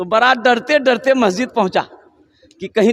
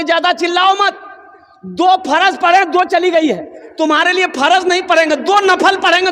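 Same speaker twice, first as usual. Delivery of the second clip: higher-pitched rustic scolding of a calf; comic scolding; 8 semitones higher than usual. Acted. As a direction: shush the calf with comic resignation. Farmer scolds crying calf.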